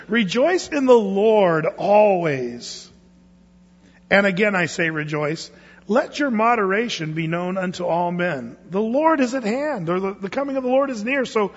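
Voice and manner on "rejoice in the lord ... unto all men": slow, weighty scripture read. Shown as a demonstration. Pastor reads the correct verse to the congregation, slow and solemn. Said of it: "It says".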